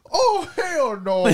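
high, slow, loud laughing "Oh, hell no."; mock outrage. Friends imagine bombing improv, hammed-up laughing outrage. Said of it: "We're just like".

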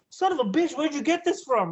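high, amazed retelling; impressed guitarist. Retold or imitated. Guitarist admiring a fan's axe, impressed. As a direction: act out an impressed rock star.

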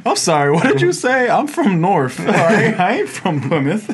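high, loud laughing tough-guy voice; mock tough reply. Retelling his pretend comeback to the customer: high loud laughing bravado. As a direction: square up jokingly. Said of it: "I look back at him".

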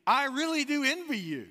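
high, loud earnest stranger's line; imitating the stranger. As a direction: raise the pitch and lean into the envy. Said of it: "And he goes".

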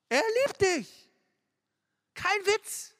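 high, loud and slow; fervent cry. Acted. Preacher assures teens God loves them, loud and slow. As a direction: cry it out to the crowd.

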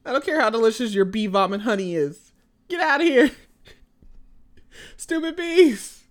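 hammed-up fury at the bees; mock rant. Stung as a kid, ranting at bees, mock rage. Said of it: "I was like".

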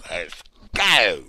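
usual speaking voice

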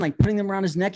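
usual speaking voice

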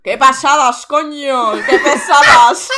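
loud, slow, laughing complaint; drawn-out exasperated groan; exasperated exclamation. Real, not acted. Exasperated exclamation about chatterers.

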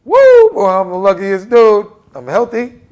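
loud, high whoop of morning joy; excited. Shown as a demonstration. Coach waking up grateful, shouting with excitement. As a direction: burst out like you just won the lottery. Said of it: "I'm smiling and excited 'cause I wake up and go".

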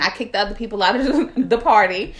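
ordinary delivery

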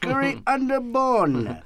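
high, slow mock Indian accent; exaggerated sing-song Indian accent. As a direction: stretch it out in a comic Indian accent. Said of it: "Or the Indian guy".

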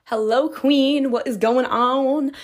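ordinary talk

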